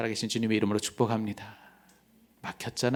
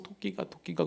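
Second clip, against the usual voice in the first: put-on child whimpering; 7 semitones higher, 7 dB quieter than usual. Child sobbing quoted again.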